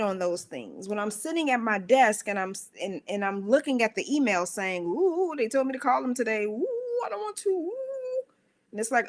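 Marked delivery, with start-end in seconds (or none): 4.8-8.2 high whimpering ooh of not wanting to; whiny reluctance. Retold or imitated. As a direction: whine like a kid dodging a chore. Whining at the email to call.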